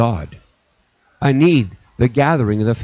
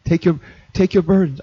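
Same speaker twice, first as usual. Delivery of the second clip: ordinary talk, nothing marked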